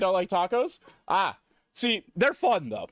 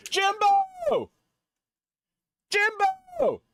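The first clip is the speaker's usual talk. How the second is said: calling out; 3 dB louder, much slower than usual. Real, not acted. Greeting a friend joining stream.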